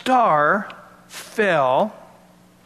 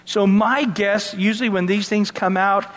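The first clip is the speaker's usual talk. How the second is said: ordinary talk, nothing marked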